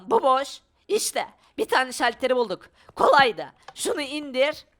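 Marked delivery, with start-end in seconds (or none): none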